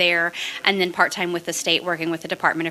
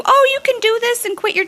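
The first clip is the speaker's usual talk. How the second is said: much higher, louder mock-cheery voice; mocking naysayers; 6 dB louder than usual. Mimicking bad advice to new investors, mock cheer. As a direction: parody the people who say quit your job. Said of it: "what they tell you".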